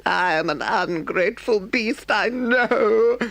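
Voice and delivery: high, breaking Toad voice, nearly sobbing; a hammed-up sob from a guilty toad. A narrator's reading. Toad sobs out his shame to Rat, hammed-up crying. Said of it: "sobbed Toad".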